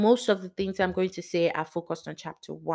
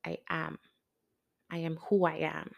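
hushed divine quote; 2 semitones lower, 6 dB quieter than usual. Retold or imitated. Quoting God's revelation.

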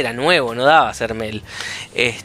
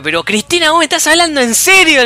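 high, loud, angry yell at the caller; retold shouting match; 13 semitones higher, 6 dB louder than usual. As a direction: shout her down, exasperated. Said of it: "gritándome con una señora". (grito enojado, agudo y fuerte)

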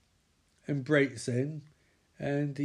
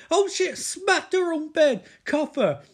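excited yell; 14 semitones higher, 7 dB louder than usual. Real, not acted. FBI raid, excited retelling.

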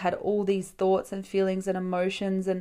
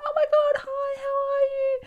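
very high-pitched gushing hello; over-the-top squeaky welcome; excited greeting; 3 dB louder than usual. Retold or imitated. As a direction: imitate a friend squealing with delight. Friend's greeting acted again.

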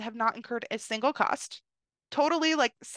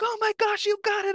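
much higher, excited driver; thrilled driver; 10 semitones higher than usual. Retold or imitated. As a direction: cheer her on like a thrilled fan. Shuttle driver thrilled for her.

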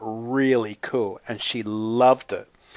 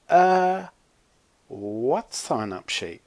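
confused; 3 semitones higher, slower than usual. A narrator's reading. Zoe to Chloe about an invented sheet, confused. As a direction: puzzle it out slowly, clueless. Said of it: "looking confused".

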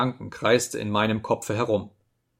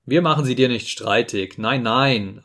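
ordinary delivery, nothing marked

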